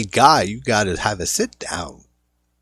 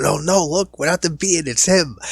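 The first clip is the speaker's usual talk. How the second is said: higher, panicky retelling of Tommy; mock freaking out; 8 semitones higher than usual. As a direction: play Tommy suddenly spooked. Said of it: "Tommy starts freaking out".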